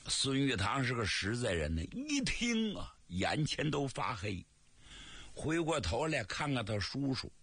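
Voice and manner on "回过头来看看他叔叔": usual speaking voice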